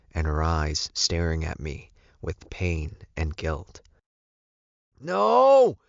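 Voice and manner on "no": long, loud high scream; drawn-out cry of horror; screaming awake. A narrator's reading. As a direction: scream it out of a nightmare. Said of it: "I screamed out of my sleep".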